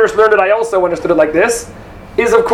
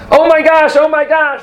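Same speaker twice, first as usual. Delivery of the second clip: mildly raised alarmed cry; mock yell; 7 semitones higher than usual. Teacher acting out a bear alarm, mild yell. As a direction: call out like you spot a bear. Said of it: "I'll be the first one to yell".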